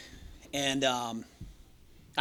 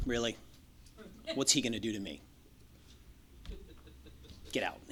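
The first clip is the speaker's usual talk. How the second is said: low, hushed, firm mom voice; mother sending staff out of the room, low and hushed; mom dismissing staff; 4 semitones lower, 11 dB quieter than usual. Retold or imitated. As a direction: quietly send them all out.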